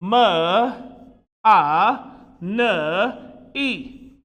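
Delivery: M, A, N, E as separate sounds "muh, ah, nuh, ee"; isolated phonics sounds for M, A, N, E. Shown as a demonstration. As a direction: break money into its sounds, pausing between. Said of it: "And the sound system is".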